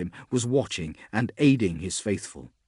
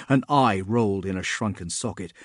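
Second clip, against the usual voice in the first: ordinary delivery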